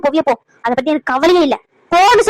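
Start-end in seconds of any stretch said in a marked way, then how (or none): 1.9-2.2 angry shout, higher and louder; shouted order. Acted. Son orders his mother out of the house, shouting. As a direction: bark the order to leave.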